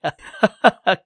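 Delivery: high, pulsed, knowing laugh; loud acted chuckle. Zha laughs at King's complaint, loud acted chuckle. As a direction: laugh heartily at the other's complaint.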